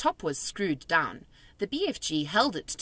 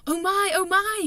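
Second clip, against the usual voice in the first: high, louder gleeful cry; delighted; 13 semitones higher, 6 dB louder than usual. A narrator's reading. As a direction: marvel at the treasure. Giant delighted with jar.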